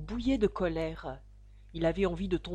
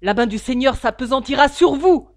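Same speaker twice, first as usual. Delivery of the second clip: loud, higher furious cry; yelling; 6 semitones higher, 16 dB louder than usual. A narrator's reading. Young suitor curses the father, yelling. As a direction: hurl the curse at him. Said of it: "hurla le jeune Drebber".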